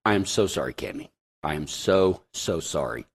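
whisper-soft sorry to his wife; tender apology. Retold or imitated.

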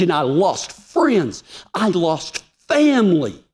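high, impassioned testimony with stretched key words; emphatic preaching. Real, not acted. Preacher testifying to the congregation about the cost, emphatic and high-pitched. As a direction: preach it high, leaning hard on each phrase.

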